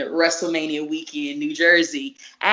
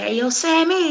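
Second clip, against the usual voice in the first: calling out; 11 semitones higher, 5 dB louder than usual. Retold or imitated. Calling out to friend, retold.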